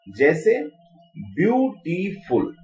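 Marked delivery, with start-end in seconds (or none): 1.4-2.5 beautiful broken into three slow beats; syllable by syllable. Shown as a demonstration. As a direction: say beautiful with a gap between syllables. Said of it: "उसमें three syllable". (Beautiful को तीन हिस्सों में तोड़कर बोलें)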